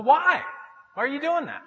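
high-pitched baffled question; mock dismay. Preacher reacting to cancelled subscription, mock dismay. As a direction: question him in comic disbelief.

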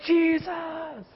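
high, excited crowd voice; high mock fan cheer. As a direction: hype him up like a fan. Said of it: "People are like".

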